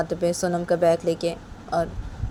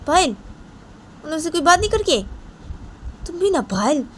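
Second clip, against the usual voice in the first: much higher, louder scolding voice; Poonam scolding; 11 semitones higher, 3 dB louder than usual. Acted. Poonam scolds Payal for carrying Sonam out, raised and high. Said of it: "तो पूनम मुझे पायल को ऐसे बोलती है".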